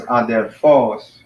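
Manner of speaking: adelPHOS, final syllable punched; last-syllable stress. Shown as a demonstration. Teacher showing the accent is on the last syllable, "adelphos" stressed at the end. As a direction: save the weight for the PHOS of "adelphos". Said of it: "accent is on the last syllable".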